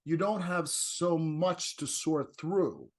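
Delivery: normal talking voice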